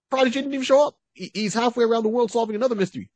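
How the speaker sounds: high, quick comic delivery; mock astonished report. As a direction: pitch it up high and rush it. Comic explanation of a missing hero.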